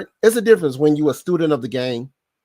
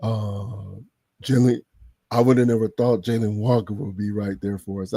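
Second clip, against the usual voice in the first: Big Ant voice; 8 semitones lower than usual. Retold or imitated. Imitating Big Ant talking about the draft pick, lightly voiced. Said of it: "in Big Ant voice".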